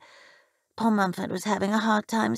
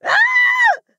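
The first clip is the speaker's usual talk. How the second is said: loud, very high sustained scream; mother's scream; 9 dB louder than usual. A narrator's reading. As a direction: scream out in terror. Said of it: "My mother let out a scream".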